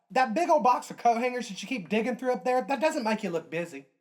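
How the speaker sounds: high, loud snarky jab; sarcastic jab. Retold or imitated. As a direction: scold them with sass. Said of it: "I thought".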